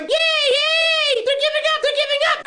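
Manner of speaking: very high, squeaky cartoon celebration; mock bear cheering. Care Bears celebrating as dwarves drop, squeaky cheer.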